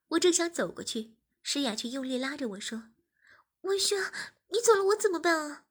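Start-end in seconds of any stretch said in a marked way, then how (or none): 3.6-5.7 put-on panicked young woman, much louder; high, loud pleading girl. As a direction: beg him to stay. 诗雅 clinging, pleading. (焦急哀求的少女)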